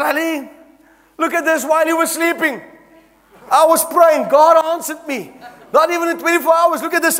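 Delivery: waving the check, bursting with news; excited. Retold or imitated. Telling his wife God answered, high and excited.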